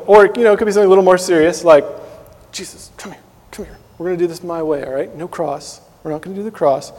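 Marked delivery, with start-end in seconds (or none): 2.5-3.8 breathy whispered beckoning, a touch higher; conspiratorial whisper. Retold or imitated. Believer whispering to pull Jesus aside, conspiratorial. 4.0-6.9 hushed coaxing. Retold or imitated. Disciple quietly beckoning Jesus to step aside, hushed and sly.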